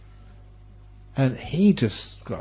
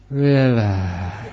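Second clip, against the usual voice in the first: soothing relax; 3 semitones lower than usual. Pretend. Reading sign in soothing voice.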